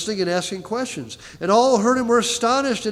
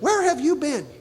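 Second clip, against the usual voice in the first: higher put-on mom voice, lightly done; exasperated mother; 8 semitones higher than usual. Preacher translating Mary's words, mock exasperated mom. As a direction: ask it like a fed-up parent. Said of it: "Translate it".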